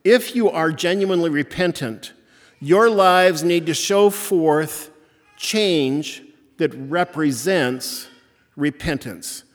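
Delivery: fiery pulpit call to repentance; forceful preaching. Acted. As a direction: preach it hard, pressing the congregation to change. Opening exhortation at full force.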